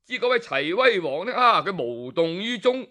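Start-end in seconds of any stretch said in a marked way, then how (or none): none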